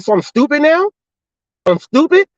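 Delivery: high, rising outraged question; indignant disbelief. Retold or imitated. Driver retorting to the worker who muttered stupid, indignant. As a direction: throw it back at him in disbelief.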